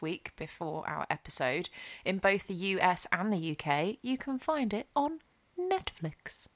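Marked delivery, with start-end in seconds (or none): none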